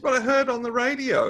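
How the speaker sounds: mock alarmed wife pushing back; worried wife. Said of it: "She said".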